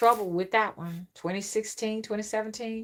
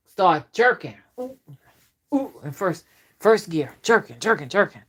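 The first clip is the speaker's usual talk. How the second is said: acted car jerking; 8 dB louder than usual.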